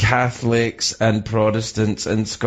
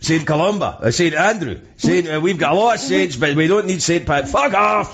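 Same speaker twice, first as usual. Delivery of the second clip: put-on higher-pitched indignant Scottish accent; 6 semitones higher than usual. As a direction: rant in Scots, building to an outburst. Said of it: "say it in your accent".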